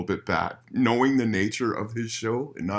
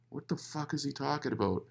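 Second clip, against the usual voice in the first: muttered to self; 6 semitones higher, 10 dB quieter than usual. Retold or imitated. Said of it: "I'm like".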